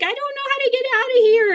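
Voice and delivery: very high, distressed whine; retold panicked wail. Lost in the apartment, telling mom, mock panicked wail. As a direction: imitate a lost kid wailing, pitch way up. Acting out being totally lost.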